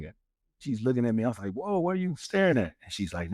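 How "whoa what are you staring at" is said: high-pitched, taken-aback quote; retold startled question. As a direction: re-enact being caught off guard. Retold startled reply to wife.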